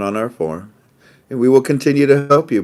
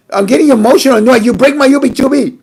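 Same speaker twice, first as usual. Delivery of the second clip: mock-emotional, higher and louder, playful put-on voice; hammed-up emotional; 8 dB louder, faster than usual. Asked for a second take, he hams up a higher, louder mock-emotional outburst. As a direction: ham up a fake emotional outburst for the retake. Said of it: "Take two".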